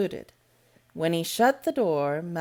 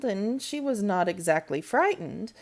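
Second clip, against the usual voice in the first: ordinary delivery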